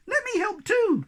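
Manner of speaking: very high, thin little squeak; high squeaky voice begging to help. A narrator's reading. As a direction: squeak it out like a tiny eager creature. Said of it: "squeaked Sniff".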